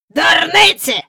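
put-on high croaked dismissal from an old man; cawing great-grandfather; old man's croak. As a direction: croak it out scornfully. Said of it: "каркнув прадідусь".

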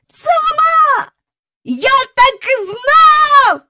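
very high, drawn-out, loud yell; elated shout. A narrator's reading. Michael recognising her at last, elated shout. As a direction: yell in triumph, stretching every word. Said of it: "закричав Майкл".

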